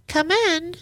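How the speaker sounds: put-on high, thin old woman calling out; old lady calling. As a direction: call out like a frail old lady.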